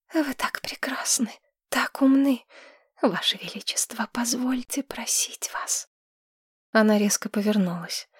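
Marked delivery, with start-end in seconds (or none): 0.1-5.9 breathy fawning whisper, higher; obsequious whisper. A narrator's reading. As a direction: flatter in a hushed whisper. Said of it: "Подобострастный шёпот". (подобострастный шёпот)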